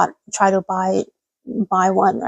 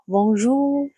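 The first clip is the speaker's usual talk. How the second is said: bonjour said softly with a French nasal vowel; a soft, careful French bonjour; 3 dB quieter than usual. Shown as a demonstration. Streamer to viewers, greeting them in a soft French accent. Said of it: "I would say, like".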